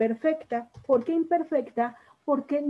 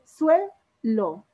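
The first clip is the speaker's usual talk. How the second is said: suelo split in two, SUE loud, then lo; teacher sounding out SUE... lo in suelo; stress on SUE in suelo; 5 dB louder than usual. Shown as a demonstration. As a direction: punch SUE in suelo, pause before lo. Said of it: "Sílaba tónica".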